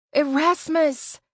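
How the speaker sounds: loud, high, drawn-out call. A narrator's reading. After choking back tears, calling the lost friend high and loud. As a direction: clear your throat and call loudly. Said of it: "I almost called out again".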